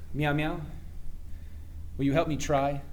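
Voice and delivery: quiet heartfelt request; earnest appeal. Acted. Earnest appeal to friend for help.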